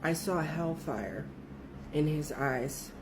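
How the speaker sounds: low, slow, hushed warning; frightened coworker's dead-serious warning, hushed and slow; ominous quote. Retold or imitated. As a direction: deliver it like a doom prophecy. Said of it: "and I quote".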